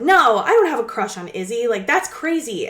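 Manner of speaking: acted-out indignant denial; intense denial. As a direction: deny it hard, as if accused. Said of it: "he's really intense about it".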